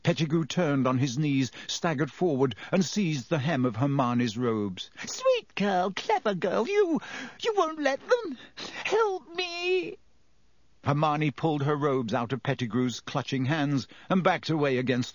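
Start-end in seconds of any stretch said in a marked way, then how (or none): 5.1-10.0 flattery climbing into a high tearful plea; wheedle, then cry high for help; desperate wheedling. A narrator's reading. Pettigrew seizes Hermione's robes, begging.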